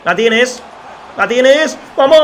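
high and loud, rising into a cheer; excited shout. Real, not acted. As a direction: cheer the striker onto the goal. Celebra el gol de Isaac. (grito de gol, agudo y fuerte)

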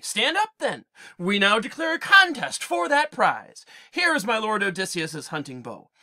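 a queen laying down the contest, rising in force; Penelope challenging suitors. Acted. Penelope's speech voiced.

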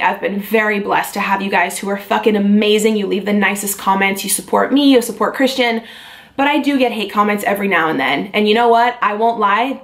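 ordinary talk, nothing marked

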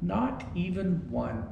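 soft, downcast storybook line; small, mournful afterthought; sad. A narrator's reading. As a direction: let it drop quietly in disappointment. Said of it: "said Tim sadly".

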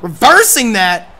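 plain live play-by-play call; wrestling commentator. Acted. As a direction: call the match like a ringside announcer.

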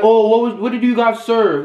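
ordinary delivery

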